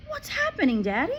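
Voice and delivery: put-on a little girl begging her father; child pleading. A narrator's reading. Matilda asks her frantic father, high and urgent. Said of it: "she cried".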